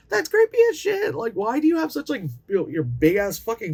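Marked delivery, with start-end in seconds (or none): 0.1-1.1 much higher, louder, outraged repeat; creeped-out squeal. Acted. Reviewer repeating her reaction, much higher and louder. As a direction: jump up into a shrill repeat.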